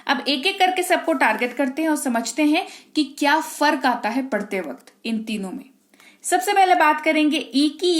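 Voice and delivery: ordinary talk, nothing marked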